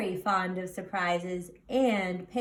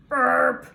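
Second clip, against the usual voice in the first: loud mock burp; piglet burp; 3 semitones higher, 10 dB louder than usual. Piglet burps after the bottle, loud mock burp. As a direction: belch it out big. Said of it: "Mercy burped".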